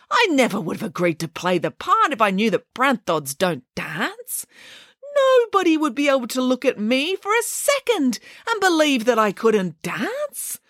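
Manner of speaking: high, lightly voiced bear, hurt; a sensitive bear explains himself, aggrieved; bear character. Bear complaining to the family about the dull scene.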